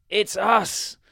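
high, loud wizard voice; story wizard. A narrator's reading. Wizard answers the king at the door, high loud reply. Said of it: "said the wizard".

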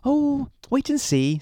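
very high, teasing wifely voice; coy, high-pitched storybook wife; Missus Grinling. A narrator's reading. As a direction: keep the secret in a woman's falsetto. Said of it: "said Missus Grinling".

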